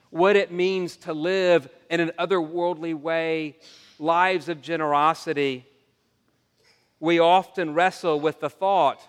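ordinary delivery

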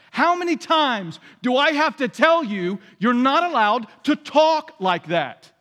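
hammed-up angry father-style rebuke; slow, loud, biting at the end; vengeful scolding. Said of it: "a vengeful response".

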